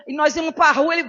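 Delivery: ordinary delivery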